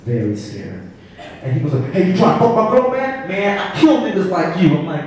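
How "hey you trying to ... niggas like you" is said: loud, high-pitched confrontational yell; mock angry threat. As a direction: play the big guy picking a fight. Big angry man threatening the teller.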